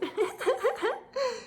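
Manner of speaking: high, light class giggling; mocking giggle. A narrator's reading. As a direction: giggle at the odd name. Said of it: "everyone giggled".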